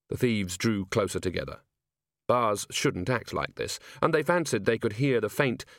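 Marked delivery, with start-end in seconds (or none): none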